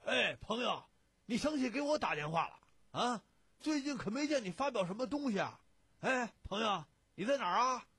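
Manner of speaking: loud, high-pitched phone hollering; boisterous, blustery friend. A narrator's reading. Boisterous friend answering an old pal's phone call, blustering. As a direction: blare it down the line, high and loud. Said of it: "传出了贾兴咋呼呼的声音".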